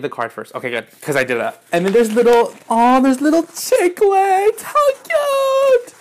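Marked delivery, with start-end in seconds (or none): 1.7-5.9 loud, soaring, very high cooing; thrilled squeal. Real, not acted. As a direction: let delight climb into a squeal. Thrilled to find chiclets.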